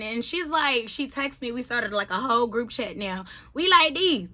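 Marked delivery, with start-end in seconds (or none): none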